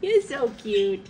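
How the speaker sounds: tender pet talk. Real, not acted.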